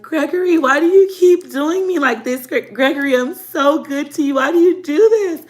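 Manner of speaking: high, whiny pleading with a lover; mock crying. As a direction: imitate your tearful younger self pleading. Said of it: "I would cry".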